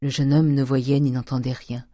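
ordinary delivery

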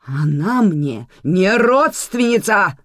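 loud, high, drawn-out denial; almost shouting. A narrator's reading. Manana disowns a supposed relative, almost shouting. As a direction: stop just short of a yell. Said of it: "чуть ли не закричала Манана".

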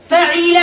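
فعل said fa-'i-la, middle vowel i; kasra on ain. Shown as a demonstration. Teacher listing verb patterns, فعل as fa'ila. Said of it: "بكسر العين".

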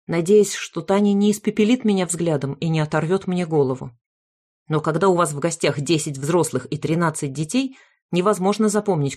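usual speaking voice

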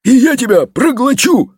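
high-pitched barked threat; barking dragon head. A narrator's reading. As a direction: bark it like a storybook dragon. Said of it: "рявкнула четвёртая". (высокий драконий рявк)

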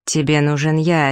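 ordinary delivery

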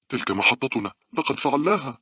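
high-pitched cry of relief; relieved shout. A narrator's reading. Girl spots their station, relieved high shout. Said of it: "صاحت مونيكا بارتياح".

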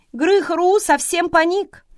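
usual speaking voice

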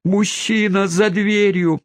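high, drawn-out mock wail; hammed-up shriek of alarm without much volume; hysterical wail. A narrator's reading. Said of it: "возопила мисс Смитерс".

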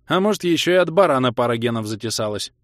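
plain reading voice

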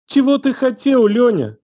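high, piercing accusing cry; furious demand. A narrator's reading. Елена demands an answer from Лёня, furious. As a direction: cry out the question in rage.

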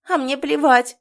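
high, loud defiant retort; heated objection. A narrator's reading. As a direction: fire back hotly. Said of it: "горячо возражала Оксана".

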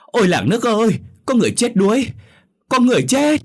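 very high, loud cry of alarm; loud shouting. Acted. Man alerting villagers to a drowning, loud shouting. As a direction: shout it to the whole village, voice soaring. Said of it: "ông liền la lớn".

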